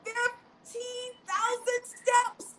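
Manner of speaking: high and slow, savouring each word; amazed echo. Real, not acted. Host echoing the guest's step count, high and amazed. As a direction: repeat it in disbelief.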